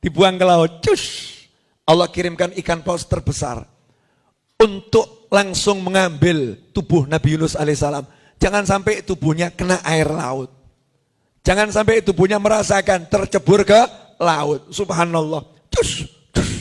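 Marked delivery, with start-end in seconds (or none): 0.8-1.4 a breathy swish sound; soft unvoiced swoosh; whispered whoosh. Whoosh into the sea. 15.7-16.5 unvoiced swoosh noises; whispered whoosh. Whoosh sound effect.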